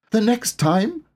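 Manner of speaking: high, small questioning voice; puzzled Piglet. A narrator's reading. Piglet asking Pooh, high and puzzled. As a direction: echo it, lost and small.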